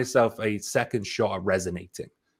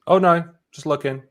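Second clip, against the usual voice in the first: higher, louder knee-jerk refusal; reflex brush-off; 8 semitones higher, 7 dB louder than usual. Retold or imitated. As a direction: wave off a shop assistant on autopilot. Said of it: "almost everyone goes".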